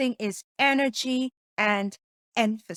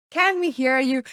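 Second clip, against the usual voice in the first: projected louder to the back of the room; 3 semitones higher, 6 dB louder than usual. Shown as a demonstration. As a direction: push the voice out so the back row hears.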